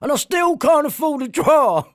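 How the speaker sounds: high loud mock shout. Mocking the protester's shouts.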